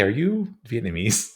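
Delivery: ordinary delivery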